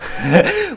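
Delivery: a quick, high burst of laughter; short laugh. Real, not acted. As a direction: laugh out at the screen. Reacts to girl's eye drops. (bật cười)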